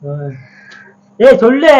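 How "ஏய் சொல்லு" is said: loud, higher yell; impatient shout. Real, not acted. Husband demanding wife continue her story, impatient shout. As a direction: bellow the demand in exasperation.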